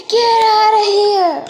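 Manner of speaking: furious banishing shout. Pretend. Pack leader throwing the pup out, slow furious shout. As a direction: drag it out and bellow it.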